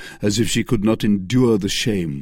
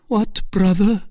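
high, louder cry; Milady's cry; 12 semitones higher, 4 dB louder than usual. Acted. Milady crying out to Felton. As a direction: cry out in mock surprise. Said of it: "cried Milady".

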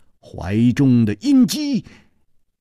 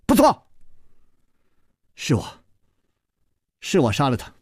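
sharp, higher bursts of a fierce confession; stern outburst; 6 semitones higher than usual. A narrator's reading. Gong Nanyan admits the killing and threatens Chu Liuxiang, fierce. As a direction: bite out the threat, rising as it goes. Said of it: "宫南雁忽然厉声说".

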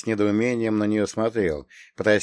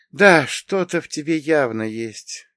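musing agreement; 6 semitones higher, 4 dB louder than usual. A narrator's reading. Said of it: "кивнула сама себе Мария".

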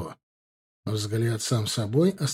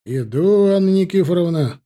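loud, raised reply from a distance; calling back; 6 semitones higher, 7 dB louder than usual. Acted. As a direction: answer her call across the yard.

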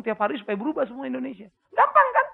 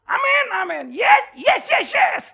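shouted high amens and yeses, much higher and louder; mock crowd cheering; 11 semitones higher, 6 dB louder, much slower than usual. Preacher mimics a hyped crowd answering him, shouted amens. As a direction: cheer like a whipped-up congregation.

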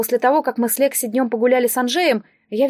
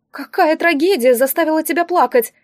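high, gushing sympathy; theatrical pity; 6 semitones higher, 4 dB louder than usual. A narrator's reading. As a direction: lament over them as if it were a tragedy. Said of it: "всплеснул руками распорядитель и кинулся ко мне жалеть". (театральное сочувствие, высоким голосом)

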